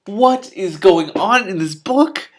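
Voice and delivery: high, loud tinny knight call. A narrator's reading. Knight crashing in on the dragon, tinny and loud. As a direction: boom out a tinny hearty greeting, slowly. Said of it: "a rather tinny-sounding voice said".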